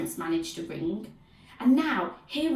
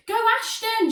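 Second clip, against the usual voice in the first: high, loud fan's cheer; shrieked; 14 semitones higher, 6 dB louder than usual. A narrator's reading. Henry interrupts teacher to cheer his team, shrieking. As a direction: shriek it in excitement. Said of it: "shrieked Horrid Henry".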